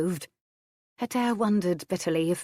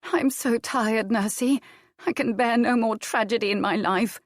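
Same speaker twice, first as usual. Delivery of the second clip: weary grief; 5 semitones higher than usual. Acted. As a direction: drag each word out in exhaustion. Adair grieving.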